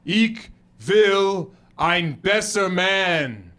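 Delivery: slow, deliberate learner's German; solemn, drawn-out German vow; earnest German declaration. Retold or imitated. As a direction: declare it word by careful word. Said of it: "'Cause I told my wife".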